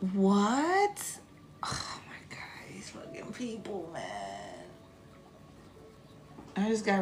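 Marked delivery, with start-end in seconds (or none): 1.6-4.7 unvoiced, exasperated whisper; whispered frustration. Real, not acted. Streamer annoyed at being restricted, whispering. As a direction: grumble it under your breath. Just got restricted, muttering aside.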